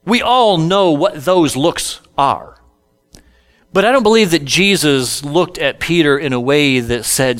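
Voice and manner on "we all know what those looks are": usual speaking voice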